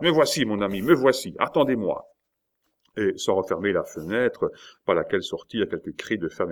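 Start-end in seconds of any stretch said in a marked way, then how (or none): 0.0-2.0 high, hurried-sounding shout down; eager raised-voice reply; calling back. Acted. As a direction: call out that help is coming. De Mouy calls down, hurrying.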